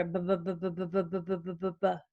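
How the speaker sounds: "bu" over and over in a rapid voiced putter; boat motor sound. Shown as a demonstration. Therapist making a boat sound, "bu" repeated in a steady chain. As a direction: chug "bu" without a break, like a motorboat. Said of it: "a boat sound where you do it over and over".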